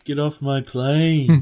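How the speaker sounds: slow British growl of a movie line; British Harrison Ford. Pretend. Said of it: "British voice with a bunch of Harrison Ford lines".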